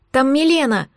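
plain reading voice

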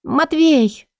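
lightly voiced, high weak woman's call. A narrator's reading. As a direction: call his name faintly, lightly voiced. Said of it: "Слабый голос Стеши".

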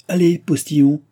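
high, loud call to the driver; shouted order. A narrator's reading. Baron ordering the postilion to drive on, shouted order. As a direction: raise the voice and send them off. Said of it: "cria monsieur de Tavernet".

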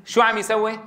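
ordinary delivery

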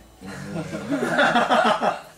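a real burst of high laughter; hearty laugh. Real, not acted. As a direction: laugh out loud at the nickname. Laughing at the nickname Caronte.

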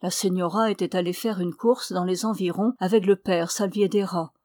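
usual speaking voice

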